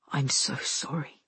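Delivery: low, very soft man's voice; quiet, intimate regret; tender apology. A narrator's reading. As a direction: apologize in a hushed murmur. Soldier apologizes, lying beside her.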